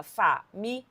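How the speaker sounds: "família" as fa, mi, li, a with pauses; four separate syllables. Shown as a demonstration. Teacher giving the grammarians' separation, "família" in four beats. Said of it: "a separação como proparoxítona".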